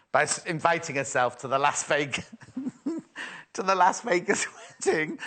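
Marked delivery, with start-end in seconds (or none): 2.8-5.2 laughing. Real, not acted. Giggling at a story.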